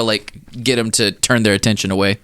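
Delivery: normal talking voice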